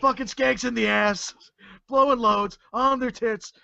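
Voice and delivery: high, hammed-up wrestler promo voice; Buff Bagwell impression. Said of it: "I feel some Austin coming on".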